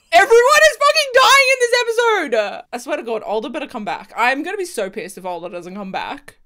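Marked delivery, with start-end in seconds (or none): 0.1-2.6 high, loud wail of disbelief; shocked outburst. Real, not acted. Viewer reacting to deaths, shocked wail. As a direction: cry out, aghast at the show.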